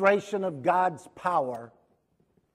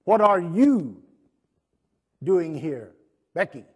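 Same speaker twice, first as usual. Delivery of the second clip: loud, direct challenge; 9 dB louder than usual. Shown as a demonstration. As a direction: call Becky out loudly. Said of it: "he could very well be saying".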